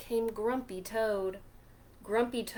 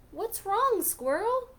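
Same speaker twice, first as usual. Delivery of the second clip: high, louder concerned question; Pete asking; 11 semitones higher, 6 dB louder than usual. Acted. Pete asking the squirrel, high and louder. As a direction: call out to him, much higher. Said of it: "Pete said".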